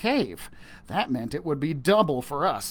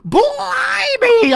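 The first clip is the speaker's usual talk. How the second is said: high, loud joyful shout; shouted blimey; 9 dB louder than usual. Acted. Boy shouting on seeing the treasure, joyful shout. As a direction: yell it in delight. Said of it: "I shouted".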